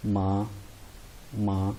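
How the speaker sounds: माँ held level and even, not drawn out; first tone demo. Shown as a demonstration. Teacher showing students the first tone, level steady माँ. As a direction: say माँ on one flat steady pitch, short. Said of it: "जैसे मैं आपको pronounce करके दिखाता हूँ".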